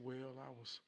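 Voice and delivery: soft, lightly voiced dad; explaining father. Father explaining the family burden to son, soft and grave. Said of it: "He said".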